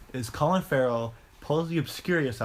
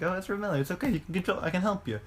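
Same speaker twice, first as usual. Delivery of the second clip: higher, softer soothing voice; gentle coaxing; 5 semitones higher, 4 dB quieter, faster than usual. Retold or imitated. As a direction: coax a scared kid softly. Coaxing the boy to calm.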